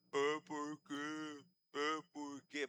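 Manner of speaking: low, dragging imitation of the stroke patient; slurred stroke speech. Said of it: "Fala direito".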